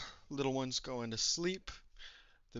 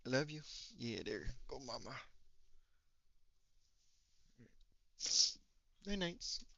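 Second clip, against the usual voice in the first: bedtime talk to pet; 10 dB quieter than usual. Real, not acted. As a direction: coo gently to a sleepy little one. Putting little one to sleep.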